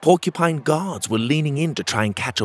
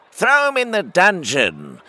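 shouting crowd voice; 9 semitones higher, 4 dB louder than usual. Acted. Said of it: "shouted one of the crabbies".

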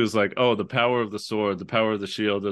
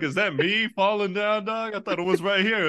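confused Sora; 8 semitones higher than usual. Retold or imitated. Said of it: "He turns around and like".

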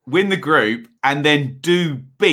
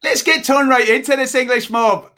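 failed Scottish accent; 8 semitones higher than usual. Shown as a demonstration. English guest mimicking host's sign-off, wobbly Scottish-turned-Irish accent. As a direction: try a Scottish brogue and slip into Irish. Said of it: "That's Irish".

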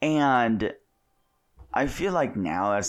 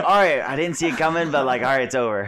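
higher, louder mock relief; high relieved kid voice; 5 semitones higher, 6 dB louder, faster than usual. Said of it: "They're just like".

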